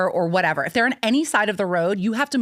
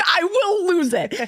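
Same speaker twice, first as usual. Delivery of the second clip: high, slow, strained wail; mock breakdown; 11 semitones higher, slower than usual. Mom warning husband she's breaking down, high wail. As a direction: stretch it out like you're cracking. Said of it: "I was like".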